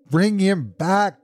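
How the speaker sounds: high, drawn-out, pleading cry; anguished cry. A narrator's reading. Mother demanding her dead son back, anguished cry. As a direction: wail the demand, stretched out. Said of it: "cried the old woman".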